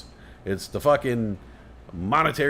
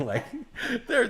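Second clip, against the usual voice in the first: ordinary talk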